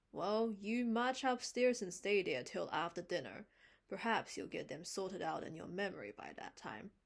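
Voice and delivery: stern order. Acted. Marilla orders Davy upstairs.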